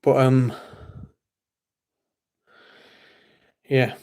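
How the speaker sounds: breathless, winded pauses; out of breath talking. Real, not acted. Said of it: "Why am I out of breath".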